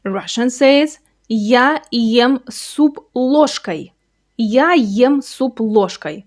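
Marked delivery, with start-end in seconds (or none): 1.3-3.9 "Я ем суп ложкой." said slowly word by word; slow demo. Shown as a demonstration. Teacher modelling the sentence for learners. 4.4-6.2 "Я ем суп ложкой." slowly again, with a different tone; slow repeat. Shown as a demonstration. Teacher repeating the sentence for learners. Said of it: "Look at that last word".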